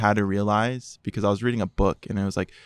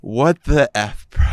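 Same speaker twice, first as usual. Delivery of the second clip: louder, slow, furious what the; angry demand; 5 semitones higher, 8 dB louder, slower than usual. Retold or imitated. Bursting in on brother playing phone games, angry and loud. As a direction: spit it out in disbelief. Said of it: "And I go".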